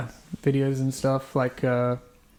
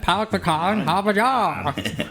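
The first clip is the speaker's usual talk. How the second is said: high, drawn-out parody Australian accent; hammed-up, high-pitched Aussie demonstration; exaggerated accent demo; 6 semitones higher than usual. Shown as a demonstration. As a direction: ham up the Australian vowels, slowly. Mock over-the-top demo of phrase.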